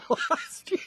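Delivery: words breaking up into laughter; laughing through words. Real, not acted. Host laughing through the news item. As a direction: reads it out while cracking up.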